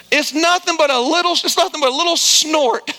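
normal talking voice

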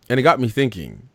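normal talking voice

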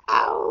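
hound howl. Pretend. Howling like a hound dog.

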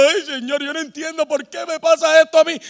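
acting out a discouraged lament; lightly hammed-up plea to God; mock tearful discouragement. Preacher imitating himself crying to God, mock discouraged. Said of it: "llorando desanimado".

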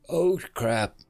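deflated, dropping after the yell; sudden dismay. Acted. Dead soldier recognises the cloaked figure, dismayed. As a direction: let the bravado fall away. Sees who he shouted at.

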